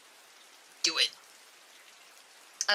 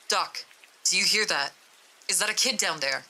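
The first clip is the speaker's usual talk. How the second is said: ordinary delivery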